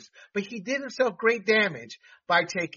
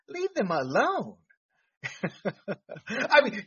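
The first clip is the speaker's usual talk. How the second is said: ordinary talk, nothing marked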